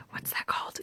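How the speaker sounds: whispered to herself; soft whispered aside. Real, not acted. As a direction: mutter the question under breath. Searching for a word.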